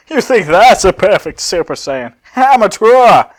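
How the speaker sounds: loud scornful villain; loud mocking Cell voice. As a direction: sneer it out loud at the rival. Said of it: "That's how he would say it".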